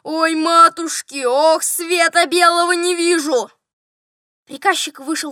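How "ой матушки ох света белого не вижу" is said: loud, slow howl of pain; wailing. A narrator's reading. General in toothache agony, wailing. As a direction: wail at full voice, dragging the words. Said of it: "вопил он".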